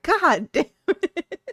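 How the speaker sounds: high, slow, laughing mock outburst; playful exasperated quote, breaking into laughs; laughing exasperation. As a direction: draw it out through laughter. Laughing self-quote after being clipped.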